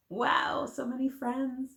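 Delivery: ordinary delivery, nothing marked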